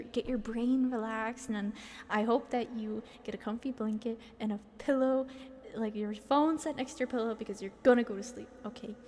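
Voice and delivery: usual speaking voice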